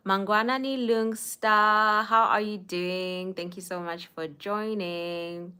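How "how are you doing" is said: ordinary delivery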